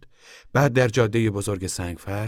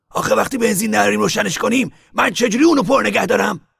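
high, loud defensive retort; sharp reply; 9 dB louder than usual. Acted. Al snapping back at Tom about gas, sharp. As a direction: fire it right back at him. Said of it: "آل به تندی جواب داد".

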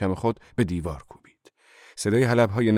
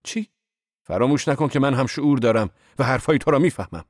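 raised, indignant objection, higher and louder; protesting; 7 semitones higher, 6 dB louder than usual. A narrator's reading. Narrator protesting to Zorba, indignant and loud. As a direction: push back hard, offended. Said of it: "با لحن اعتراض آمیزی گفتم".

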